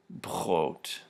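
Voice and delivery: brot with a voiceless throaty German R. Shown as a demonstration. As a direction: say brot with the R scraped at the uvula. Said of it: "These are unvoiced uvular fricatives".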